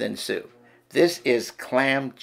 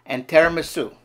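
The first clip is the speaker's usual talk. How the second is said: a hammed-up Italian accent, serving with gusto; Luigi Italian accent; 3 semitones higher than usual. Waiter Luigi welcoming diners in an Italian accent. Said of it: "Listen and learn".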